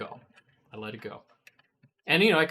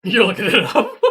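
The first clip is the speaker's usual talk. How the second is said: laughing question; 8 semitones higher, 10 dB louder, faster than usual. Real, not acted. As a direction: ask it through a laugh. Laughing reaction.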